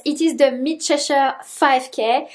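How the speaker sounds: ordinary talk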